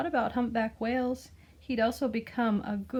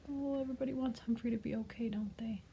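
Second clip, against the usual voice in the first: whisper; 2 semitones higher, 7 dB quieter, faster than usual. Real, not acted. Aside to children, hushed.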